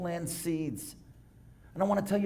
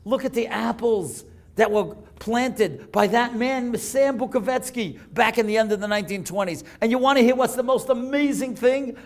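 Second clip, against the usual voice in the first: preaching at full force; 6 semitones higher, 7 dB louder than usual. Acted. Preacher tells the congregation to see the apples, rising loud. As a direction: hammer the lesson home to the congregation. Preacher drives home the point.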